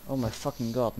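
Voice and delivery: ordinary talk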